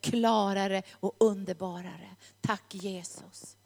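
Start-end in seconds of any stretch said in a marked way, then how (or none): 2.4-3.6 soft whispered "Tack Jesus", slow; whispered prayer. Real, not acted. (viskad bön)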